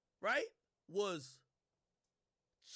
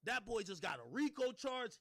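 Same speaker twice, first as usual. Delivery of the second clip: ordinary talk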